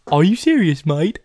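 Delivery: incredulous imitated British customer; British accent impression. Customer hearing the party is free, incredulous British accent. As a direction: put on a British accent, challenging. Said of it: "this guy's really British".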